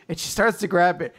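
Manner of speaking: ordinary talk, nothing marked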